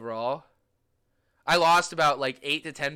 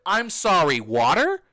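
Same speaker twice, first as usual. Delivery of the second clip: loud, higher, incredulous question; indignant disbelief; 5 semitones higher, 9 dB louder, slower than usual. Acted. Customer balking at paying for water, indignant disbelief. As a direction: throw it out loudly as if affronted.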